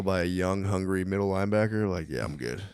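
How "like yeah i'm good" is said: mock quiet brush-off. Declining the risky catch.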